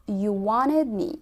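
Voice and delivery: "you wanted me" with the T vanishing; slow and drawn out, no T in "wanted"; dropped T. Shown as a demonstration. Teacher slowly modelling American "wanted" without T. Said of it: "مفيش تي".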